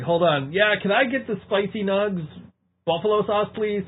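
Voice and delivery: high, hammed-up ordering voice; mock drive-thru order. Teasing friend mimics him ordering at drive-thru, high mock voice.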